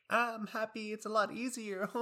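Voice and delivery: high, mock-cheerful chirp. Student pretending the semester is easy, high mock cheer. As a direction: sing it falsely bright and high. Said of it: "I don't wanna say anything like".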